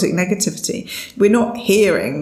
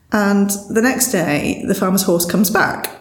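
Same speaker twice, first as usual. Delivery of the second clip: ordinary delivery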